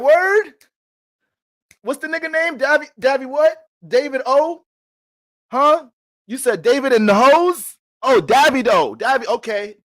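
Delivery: very high, loud, incredulous questions; hammed-up bewilderment. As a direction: squawk each confused question high and loud. Acting himself mishearing a name.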